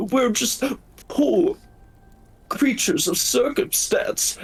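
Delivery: the wolf pleads innocence to the party in a very high voice; pitiful wolf. Acted. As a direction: play the poor victim, pitifully high.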